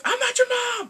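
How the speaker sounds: high, loud angry outburst; hammed-up furious shout; mad mom. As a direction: yell it like a fed-up mother in a melodrama. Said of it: "her mom got mad".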